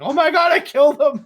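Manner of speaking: high, loud mock panic with a laugh; panicked exclamation. Retelling Leon's panic after the backdrop, loud laughing mock alarm. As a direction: imitate a friend freaking out, laughing. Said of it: "fucking Leon's just like".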